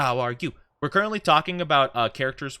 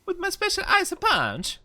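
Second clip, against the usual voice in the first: very high, squeaky joke impression; hammed-up high-pitched character boast; Risley. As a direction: deliver a silly catchphrase in a far higher voice. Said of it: "it's my Risley impression".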